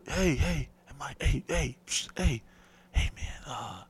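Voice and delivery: hushed. Retold or imitated. Dave sneaking out to check in, whispering. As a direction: whisper it so nobody hears.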